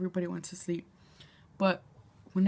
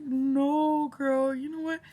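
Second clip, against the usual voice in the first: higher, louder playful no; emphatic refusal; 6 semitones higher, 3 dB louder than usual. Retold or imitated. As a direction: turn her down loud and sassy. Retold refusal of pills.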